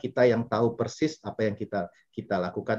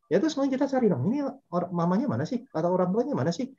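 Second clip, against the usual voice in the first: annoyed wondering; 8 semitones higher, faster than usual. Retold or imitated. Annoyed by noisy children.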